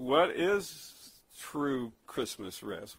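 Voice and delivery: normal talking voice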